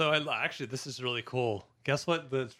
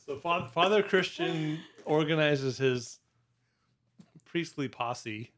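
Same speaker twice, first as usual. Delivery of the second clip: laughing; 4 semitones higher, slower than usual. Real, not acted. Laughing through the priestly posse line.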